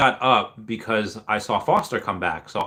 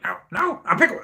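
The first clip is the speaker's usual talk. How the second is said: bad Pickle Rick impression; 6 semitones higher than usual. Streamer imitating a kid in McDonald's, bad squeaky cartoon impression. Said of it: "in a really bad, like, accent".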